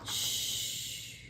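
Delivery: soft unvoiced shush; hushing. A narrator's reading. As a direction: hush so the bear sleeps. Narrator hushes listeners.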